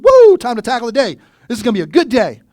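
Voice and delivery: loud, high, hyped-up morning cheer; excited wake-up cheer. Retold or imitated. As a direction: burst out like the day is already won. Acting out waking up happy.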